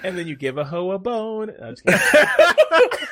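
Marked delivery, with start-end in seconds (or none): 1.6-3.1 high, loud laugh breaking through the words. Real, not acted. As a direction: laugh it out while taking the joke back. Joke followed by laughter.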